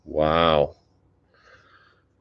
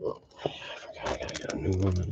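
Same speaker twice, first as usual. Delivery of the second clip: whispered aside to himself; whispering; 13 dB quieter than usual. Real, not acted. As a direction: breathe it out under his breath. Muttered aside while opening cards.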